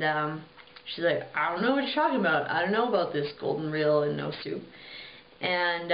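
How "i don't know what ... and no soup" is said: hasty denial. Retold or imitated. Girl denying to the king.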